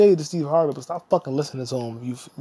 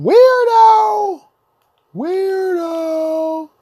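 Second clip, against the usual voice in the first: much higher, much louder, slow shriek; shrieking yell; 14 semitones higher, 9 dB louder, much slower than usual. Acted. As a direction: shriek it like she's lost it. Ranting at Wanda, shrieking.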